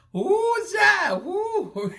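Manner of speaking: high, loud whoo of delight; excited whoop. Real, not acted. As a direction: let out a high whoop at the gossip. Reacting to juicy gossip.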